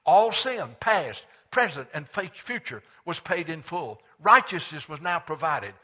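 loud, high, impassioned pulpit climax; triumphant, driving sermon delivery; preaching. Real, not acted. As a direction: preach it at full force. Preaches at full force.

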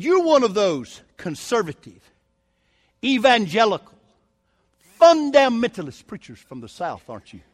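loud, slow, drawn-out mock disdain; very sarcastic. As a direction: stretch each label out sarcastically. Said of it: "very sarcastically said".

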